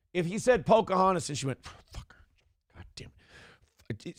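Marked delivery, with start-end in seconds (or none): 1.6-4.2 furious whisper through clenched teeth; seething whisper. Shown as a demonstration. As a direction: hiss it under your breath, seething. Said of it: "Seething is, like, teeth clenched".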